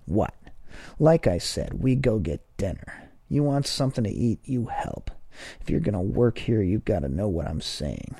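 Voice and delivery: patronising, lightly voiced, pushy and quick in places; a boss lecturing a new hire as if to a child; talking down to a child. A narrator's reading. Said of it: "as if he were talking to a child".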